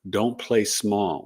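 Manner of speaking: usual speaking voice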